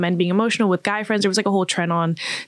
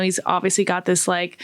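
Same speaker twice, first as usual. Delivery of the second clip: ordinary delivery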